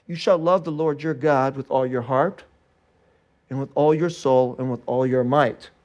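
low, measured recitation; scripture recitation. Acted. Recites verse five again.

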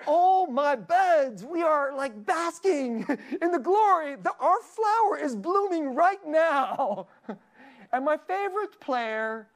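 high, loud, drawn-out joy over the win; gleeful gushing. Real, not acted. Preacher gushing to congregation about his team winning, loud and high. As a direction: savor it like a fan basking in victory.

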